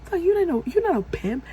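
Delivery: high-pitched retold rant; going off. Storyteller replays telling off a would-be pimp, high and indignant. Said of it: "So I'm going off".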